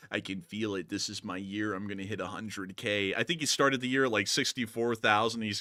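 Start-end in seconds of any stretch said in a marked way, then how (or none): 0.1-3.1 softer, lowered imitation of a hopeful guy; a hint of smug self-belief; mock confident YouTuber. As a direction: hush it like a quiet boast. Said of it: "He was like".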